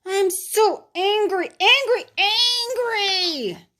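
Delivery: put-on high, loud child voice, slowing on the last angry; angry boy. Boy sent to his room, high angry child voice. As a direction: stamp out each angry like a sulking kid.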